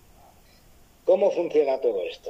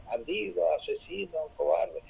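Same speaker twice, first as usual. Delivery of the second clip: softer faded repeat of the insults like an echo; the cave echoes the boy's insults back, softer; 4 semitones higher, 6 dB quieter than usual. Retold or imitated. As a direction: give the words back quieter, as a distant cave echo. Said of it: "y el eco le decía".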